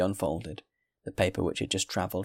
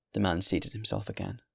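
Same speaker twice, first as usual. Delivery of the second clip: ordinary delivery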